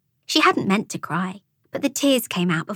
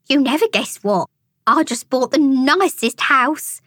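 high, gushing toad showing off; oozing; 6 semitones higher, 3 dB louder than usual. A narrator's reading. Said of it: "Frida oozed".

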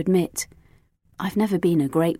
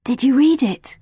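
anxious, tentative question; a girl nervously asking if her secret was found; 7 semitones higher, 4 dB louder than usual. A narrator's reading. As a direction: ask it nervously, dreading the answer. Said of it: "I asked nervously".

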